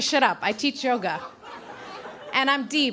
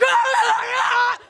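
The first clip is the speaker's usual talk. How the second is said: high, loud terrified shriek; screaming, laughing through it; scared scream; 11 semitones higher, 5 dB louder, slower than usual. Shown as a demonstration. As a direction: shriek at the door in panic. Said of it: "it was really something like that".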